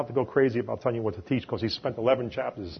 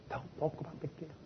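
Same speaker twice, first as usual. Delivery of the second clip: soft quoted aside; 5 semitones higher, 14 dB quieter than usual. Retold or imitated. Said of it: "Lauren said that".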